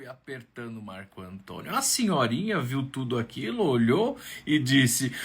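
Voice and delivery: usual speaking voice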